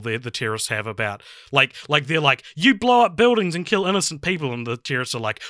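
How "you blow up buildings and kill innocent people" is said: higher-pitched indignant retort; mock accusation. Film heroes accusing the terrorists, mock indignation. Voices characters arguing with terrorists.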